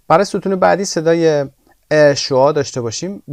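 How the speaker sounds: usual speaking voice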